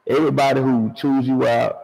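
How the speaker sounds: ordinary talk, nothing marked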